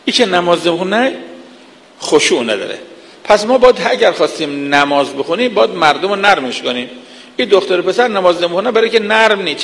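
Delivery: usual speaking voice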